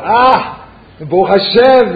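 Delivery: high, loud happy cry of thanks. Retold or imitated. Reb Chatzkel imagined crying out happily, high and loud. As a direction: burst out in relief and joy. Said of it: "we would be so happy".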